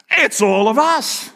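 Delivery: high, slow, drawn-out exclamation; stretched, high-pitched cry of realization; emphatic revelation. Acted. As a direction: proclaim the opposite discovery with full conviction. Acts out opposite reading, new glasses.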